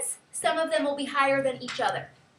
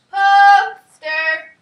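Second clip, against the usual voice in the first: loud, sung-out call, higher pitch, long vowels; calling to dinner; 6 semitones higher, 14 dB louder than usual. Shown as a demonstration. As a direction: holler it up the hill as if for dinner. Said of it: "Call it up on the hill".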